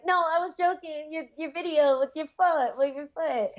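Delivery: high-pitched, hasty mock apology; flustered backpedalling. Fighter mimicking a fan who might have offended her, flustered. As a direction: imitate a fan scrambling to explain himself. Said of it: "suddenly realized he might have offended me".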